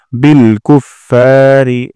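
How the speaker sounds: "কুফফারি" with ফা held one alif long; slow, stretch the ফা; lengthened fa. Shown as a demonstration. Teacher showing the long ফা. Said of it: "ফাতে এক আলিফ টান দিব".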